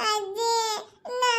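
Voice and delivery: high, drawn-out tearful wail; crying child. Acted. Child appealing to grandmother after scolding, crying. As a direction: wail for help like a little child. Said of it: "ಅಳಕ ಚಾಲು ಮಾಡ್ಬಿಟ್ಟಾನ".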